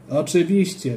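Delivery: ordinary delivery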